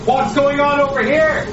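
loud high shout, rising at the end; shouting over engine. Acted. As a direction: yell it across the noisy room. Repeated louder over noise.

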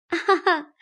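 a short high laugh, ha ha; light laugh. A narrator's reading. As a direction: laugh brightly, a little embarrassed. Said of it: "秋文笑道". (轻笑一声，偏高)

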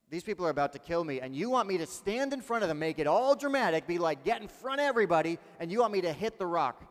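loud, rising disbelief; incredulous protest. Acted. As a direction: protest loudly, pitch jumping on the key words. Imagined incredulous protest to God.

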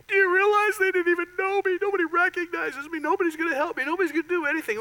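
high-pitched, self-pitying mock whine; mock pity party. Preacher mimicking someone at a pity party, mock whine. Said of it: "recipe for a great pity party".

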